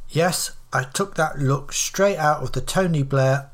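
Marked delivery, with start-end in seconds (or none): none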